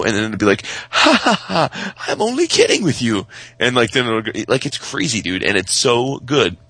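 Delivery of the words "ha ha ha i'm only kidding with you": much higher mock-laughing toy voice; teasing toy voice. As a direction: tease like a cheeky little gadget. Toy teasing after wrong guess.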